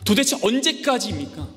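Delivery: the prophet complaining aloud to God, high and loud; Habakkuk's complaint. Acted. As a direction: cry out in frustration at God. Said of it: "하박국 선지자가 이렇게 물어보는 거예요".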